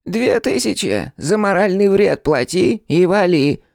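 high-pitched rodent demanding payment; rat boss voice. A narrator's reading. Rat pack leader demanding money for moral damage, high squeaky demand.

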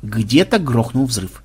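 usual speaking voice

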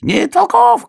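very high, loud squeal; крик ёжика. A narrator's reading. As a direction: squeal it out triumphantly. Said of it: "кричал ежик". (визгливый крик ёжика)

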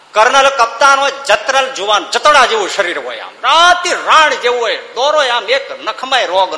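declaimed verse. Acted. Verse refrain declaimed again.

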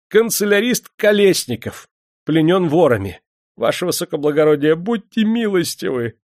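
high, loud whimpering clerk; servile, tearful entreaty; tearful pleading. A narrator's reading. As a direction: beg for mercy in a high voice. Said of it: "слезливо умолял чиновник".

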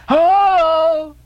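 long, high, loud "Ho!"; hailing cry. A narrator's reading. Elijah hailing the departing friends, loud drawn-out cry. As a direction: hail them from a few paces off. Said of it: "cried stationary Elijah, hailing us".

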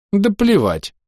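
high, emphatic brush-off; categorical dismissal. A narrator's reading. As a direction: dismiss it flatly out of hand. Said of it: "категорично ответила гнома".

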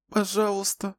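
put-on a small girl begging through tears; crying little girl. A narrator's reading. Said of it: "заплакала девочка". (плачущая девочка, высоко)

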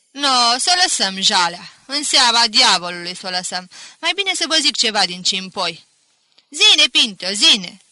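full-voiced, spirited urging; rally the room for a tune; loud rousing call. A narrator's reading. Pinto offers a bagpipe tune to villagers, loud and rousing. Pinto rouses company, urged to play.

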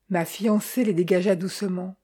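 ordinary delivery

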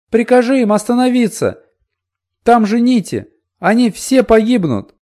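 high, loud frantic plea; child begging. A narrator's reading. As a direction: beg the queen desperately. Girl begs the Queen.

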